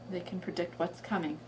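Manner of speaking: usual speaking voice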